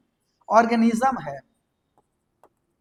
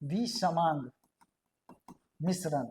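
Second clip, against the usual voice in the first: विसमांग, pause, then मिश्रण, softer and falling; slow split repeat; 4 semitones lower, 8 dB quieter, slower than usual. Shown as a demonstration. Teacher repeating the term word by word for students. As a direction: break विसमांग मिश्रण into two slow words. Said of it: "दूसरे को बोलेंगे विसमांग मिश्रण".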